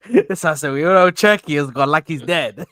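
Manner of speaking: loud, teasing echo of a co-host; mock-grave imitation. Teasing a co-host over an injured player, mock-grave. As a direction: play up the loss as if it were a death. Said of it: "Why do you say it like that?".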